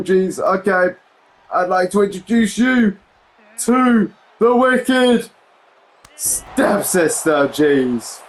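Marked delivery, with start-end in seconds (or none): 1.5-7.5 mock ring announcer. Mock ring introduction of wrestler.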